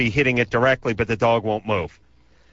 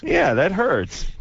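mock hurt; 7 semitones higher, slower than usual. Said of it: "mock wounded reaction".

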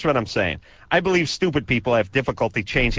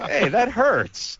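mock hurt; 11 semitones higher, much slower than usual. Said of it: "mock wounded reaction".

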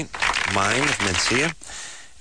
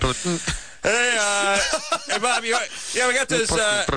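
higher-pitched mock worker talk over the beat; put-on tradesman rattling off job orders, pitched up; drywaller at work; 10 semitones higher than usual. Acts a sheetrocker singing over beat.

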